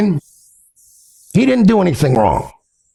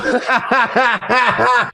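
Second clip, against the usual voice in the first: high, rapid cackling laugh; amused laugh; 8 semitones higher than usual. Real, not acted. Streamer laughing at a chat message, amused. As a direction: burst out laughing in a rapid run.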